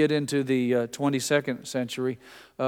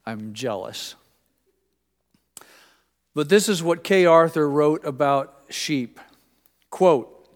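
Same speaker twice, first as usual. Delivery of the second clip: ordinary talk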